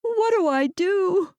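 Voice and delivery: high, slow, sobbing question; through sobs. A narrator's reading. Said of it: "she asked through sobs".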